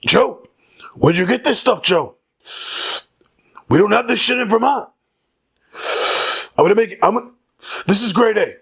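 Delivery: hammed-up Bernie marveling at the weed; Bernie Sanders impression. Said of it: "that was my Bernie Sanders impression".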